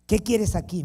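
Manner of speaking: "¿Qué quieres aquí?" as a sharp, loud challenge; sharp demand. Retold or imitated. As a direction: snap "¿Qué quieres aquí?" quickly at the door. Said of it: "me dijo".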